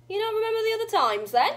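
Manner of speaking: cockney. Acted. Granny asks about other times, slipping into cockney. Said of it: "he just went all cockney".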